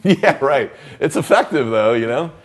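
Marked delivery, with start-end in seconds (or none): 0.0-0.7 laughing it off, then a loud yeah; real laugh. Real, not acted. Laughing after quoting the blunt line to the student. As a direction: laugh out loud at your own story.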